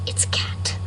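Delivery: hushed whisper of the answer; whispered aside. Retold or imitated. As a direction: whisper it like a secret. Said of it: "you'll be like".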